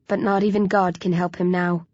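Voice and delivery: plain reading voice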